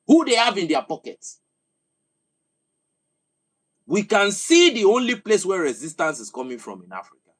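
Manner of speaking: loud, high-pitched oration pushing hard; fiery rant. Real, not acted. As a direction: hammer each point home to the crowd. Impassioned political rant.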